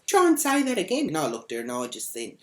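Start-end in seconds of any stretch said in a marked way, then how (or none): 0.1-1.1 loud, high mock threat; in-your-face threat. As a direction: imitate an irate woman daring someone, loud and high. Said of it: "comes up in my face and she goes".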